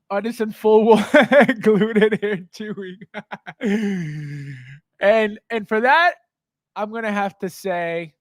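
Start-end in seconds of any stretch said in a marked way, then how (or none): 0.1-4.5 breaking into real laughter mid-sentence; words broken up by chuckles; laughing while reading. Real, not acted. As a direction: read it out while cracking up. Cracks up reading Rudy's comment.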